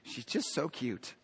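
soft, slow and low doting voice; tender murmur of affection; fond aside. Retold or imitated. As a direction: coo it like an amused angel. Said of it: "she was saying".

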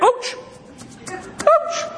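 high squeaky yelps of mock pain; mock ouch. Pastor squealing ouch like a pricked porcupine.

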